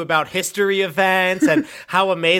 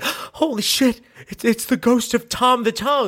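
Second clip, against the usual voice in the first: higher, startled gasp of recognition; a touch of mock awe; awed surprise; 6 semitones higher than usual. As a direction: react like seeing a legend. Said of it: "they're like, gasps".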